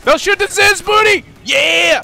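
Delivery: very high, loud gamer shout; real over-excited high scream; excited yelling. Real, not acted. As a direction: yell the order, carried away with excitement. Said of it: "Sorry, I yelled. ... I just get too excited".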